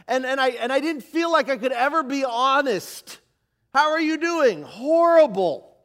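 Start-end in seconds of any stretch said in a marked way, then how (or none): 3.7-4.6 bright, louder, higher church hello; cheery greeting. Retold or imitated. Churchgoer greeting a brother, loud and cheery. 4.6-5.8 slow, loud, flat-out "Horrible."; blunt honest answer. Retold or imitated. Teen answering a churchgoer's greeting, slow and loud.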